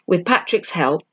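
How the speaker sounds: ordinary delivery, nothing marked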